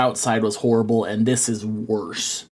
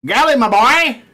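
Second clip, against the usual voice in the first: calling out loud; 12 semitones higher, 6 dB louder than usual. Real, not acted. GM calling absent player.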